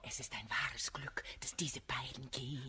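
hushed, confidential whisper; confiding whisper. Acted. Arsinoé to Célimène once the men are gone, whispered. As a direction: lean in and share a secret.

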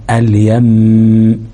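اليم stretched on a level closing hum; held ghunna. Shown as a demonstration. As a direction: dwell on the doubled م ending اليم. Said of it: "أو على الميم المشددة". (مدّ الغنة في ميم اليم)

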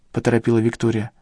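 ordinary delivery

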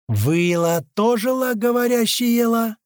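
slow, high monkey voice; talking monkey. A narrator's reading. Second monkey answering the men, high slow creature voice. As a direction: draw it out high and odd. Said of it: "произносит вторая мартышка".

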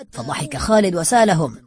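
usual speaking voice

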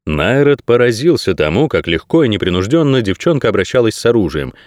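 ordinary delivery, nothing marked

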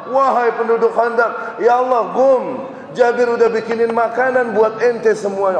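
high, loud summons; calling out loud. Retold or imitated. Prophet summoning the trench army, loud call. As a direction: call everyone to eat. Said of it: "dia teriak sekenceng-kencengnya".

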